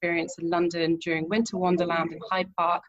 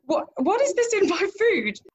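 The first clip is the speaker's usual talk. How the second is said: laughing, much higher then louder alarmed question; shocked laugh; 11 semitones higher, 4 dB louder than usual. Retold or imitated. Finding plastic in the birthday dessert, laughing high shock.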